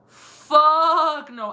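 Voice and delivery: loud, drawn-out retold "no way"; emphatic refusal. Woman retelling her refusal at the car window, emphatic. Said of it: "I actually said".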